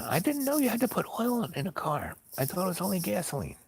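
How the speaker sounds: very high-pitched imitation of her; high, squealed self-justification; mock screaming complaint. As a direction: mimic her shrill protest without the volume. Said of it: "she's still screaming at me".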